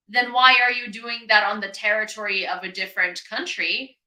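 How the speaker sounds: ordinary talk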